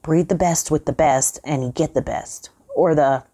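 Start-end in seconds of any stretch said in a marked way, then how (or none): 2.7-3.3 put-on old man's words fade into a chuckle; trailing into laughter. A narrator's reading. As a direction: let the line die into a laugh. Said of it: "the old man's voice trailed off as he started to laugh".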